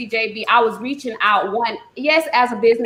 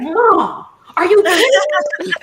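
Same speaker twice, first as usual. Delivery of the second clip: a hint of a stunned teen son, higher and louder; voicing her son hearing the booking news, lightly surprised; son's disbelief; 8 semitones higher, 4 dB louder than usual. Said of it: "he was like".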